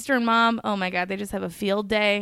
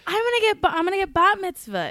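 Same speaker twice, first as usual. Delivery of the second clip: excited; 10 semitones higher, 3 dB louder, faster than usual. Real, not acted. Gasp, excited news.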